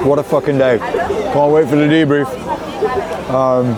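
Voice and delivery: talking while chewing; words round a mouthful of fries; mouth full. Real, not acted. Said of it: "I'm talking with my mouth full".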